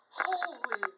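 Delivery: high startled exclamation; shocked gasp. Retold or imitated. Scared investigator blurting in shock, high and loud. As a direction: cut off in fright. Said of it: "I go".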